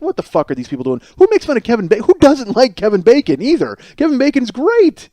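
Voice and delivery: indignant disbelief. Retold or imitated. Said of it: "I'm like".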